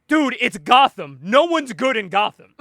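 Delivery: loud, drawn-out "dude" reply; exasperated retort. Retold or imitated. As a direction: stretch it out like it's obvious. Retelling character's exasperated reply.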